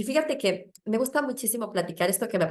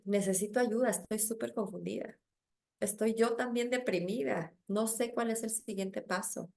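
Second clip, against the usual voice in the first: much softer, fragile confession; quiet plea for help; 7 dB quieter than usual. Retold or imitated. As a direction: admit you are lost, barely audible. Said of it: "y decir". (confesión muy suave y frágil)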